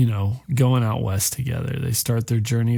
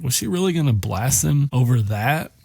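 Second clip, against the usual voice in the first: ordinary delivery, nothing marked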